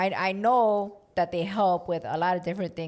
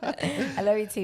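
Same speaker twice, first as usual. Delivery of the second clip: laughing. Real, not acted. Laughing reply.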